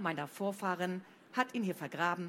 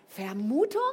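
louder indignant echo; indignant interruption; 3 semitones higher, 8 dB louder than usual. A narrator's reading. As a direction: cut her off in disbelief. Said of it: "fiel Flo der Direktorin ins Wort".

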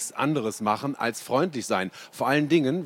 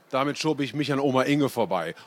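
ordinary delivery

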